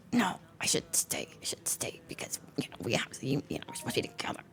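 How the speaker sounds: muttered inner-voice whisper, very soft; talking herself into staying with a friend, whispered; whispered thought. Retold or imitated. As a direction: whisper it like a guilty thought to yourself.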